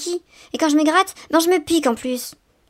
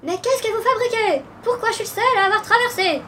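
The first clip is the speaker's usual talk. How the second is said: higher call to the far side; calling across the road; 7 semitones higher than usual. A narrator's reading. Papa calls from across the road, raised call. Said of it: "Papa Hérisson cria".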